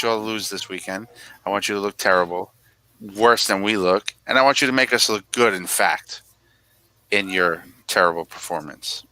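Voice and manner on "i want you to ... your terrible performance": ordinary talk, nothing marked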